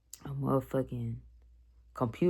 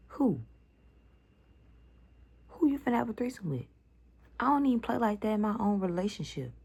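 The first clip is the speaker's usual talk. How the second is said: outraged retort; 5 semitones higher than usual. Retold or imitated. To her cousin in the car, high indignant rant. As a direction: shut her down, scandalised.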